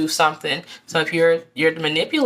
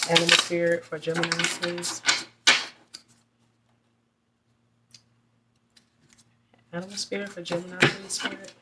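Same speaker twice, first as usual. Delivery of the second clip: soft, fast murmured plea to the cards; quick hushed invocation, repeated like a chant; asking the spirit; 7 dB quieter than usual. Real, not acted. Reader softly asking the spirit for a card, fast and hushed.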